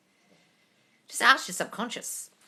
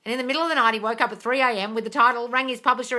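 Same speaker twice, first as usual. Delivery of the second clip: ordinary talk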